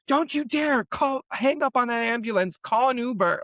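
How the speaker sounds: high, comically urgent retold plea; indignant protest. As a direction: forbid it in mock outrage, pitched right up. Retells forbidding a costly ambulance.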